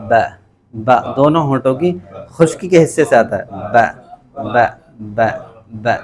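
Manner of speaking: با pressed lips then released with a bounce; qalqala ba demo. Shown as a demonstration. Teacher demonstrating qalqala on با for students. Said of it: "بھینچ کر پڑھتے ہیں، کلکلا جیسا sound".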